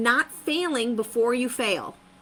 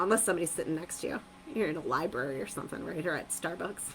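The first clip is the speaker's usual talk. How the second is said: hushed aside; 6 semitones lower, 9 dB quieter, much faster than usual. Real, not acted. Aside about library quiet.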